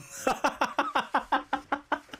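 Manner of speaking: soft laugh. Real, not acted. Host laughs after explaining.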